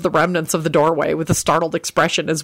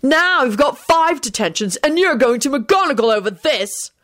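angry prefect; 8 semitones higher than usual. A narrator's reading. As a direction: pronounce the punishment indignantly. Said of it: "he yelled".

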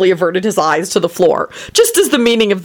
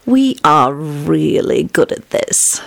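purred; 2 semitones lower, much slower than usual. A narrator's reading. Wife curled against husband, slow contented purr. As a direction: purr it to your husband, drowsy and pleased. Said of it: "purred a naked Elizabeth".

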